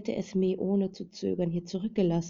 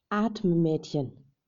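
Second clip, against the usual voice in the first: slow, louder urgent call of atme; 5 dB louder, much slower than usual. A narrator's reading. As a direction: stretch atme out and call it loudly. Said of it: "rief eine Stimme plötzlich". (langsam und laut gerufen: atme)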